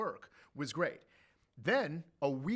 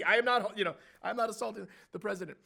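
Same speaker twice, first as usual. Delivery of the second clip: normal talking voice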